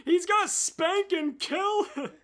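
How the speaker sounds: high, loud alarmed cry; panicked shout. Acted. The worried tail panicking aloud, high and loud. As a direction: blurt it out in alarm.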